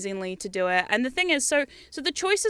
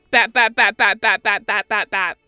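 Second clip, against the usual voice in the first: loud punchy bat bat bat; swatting sounds; 2 semitones lower, 7 dB louder than usual. Retold or imitated. Cats swatting each other, punchy loud bats.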